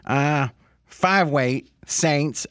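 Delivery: slow, drawn-out imitation of a colleague; Fez. Host reads absent colleague's picks, slow imitation. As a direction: take it at half speed, as a co-host impression. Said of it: "do it in a Fez voice".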